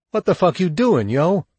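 high, loud, distressed protest; imploring. A narrator's reading. Shug to the men beating him, imploring. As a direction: plead in alarm with an attacker. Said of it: "he implored".

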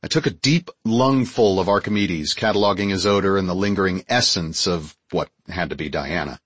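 usual speaking voice

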